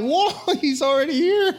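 high-pitched comic "whoa"; playfully astonished, voice leaping up with a laugh; mock-startled discovery; retold surprise. Retold surprise finding God there.

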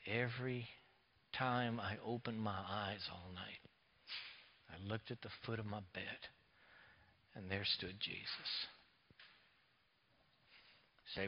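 very soft and low, hushed; hushed deathbed voice. Retold or imitated. Preacher retells Leon's deathbed words in a hushed voice. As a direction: speak as if from a deathbed, barely above a breath.

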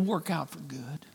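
whispered, voiceless promise. Real, not acted. Preacher whispers reassurance to the congregation, unvoiced. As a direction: breathe it out as a whisper.

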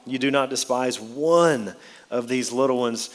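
"one" stretched and lifted in pitch; stress on one. Shown as a demonstration. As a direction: hold out the word "one". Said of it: "Notice the emphasis on that word one".

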